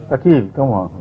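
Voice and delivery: ordinary delivery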